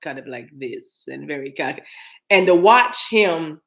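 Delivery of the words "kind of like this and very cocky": low, soft villain voice; cocky James Bond villain. Retold or imitated. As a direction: drop low and quiet, full of cockiness. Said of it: "a James Bond villain character".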